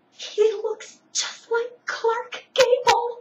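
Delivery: high, loud, slow swooning admiration; starstruck gush. Retold or imitated. Friend gushing to the narrator about her handsome father, high swooning. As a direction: gush it like a smitten fan.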